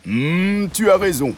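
high, cheerful cow; a friendly cow agreeing. A narrator's reading. The cow agrees with the frog, high and cheerful.

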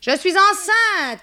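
very high, loud, drawn-out announcement; crying out. A narrator's reading. Macaré announcing her pregnancy to her landladies, crying out. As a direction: shout the news to everyone. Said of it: "cria Macaré".

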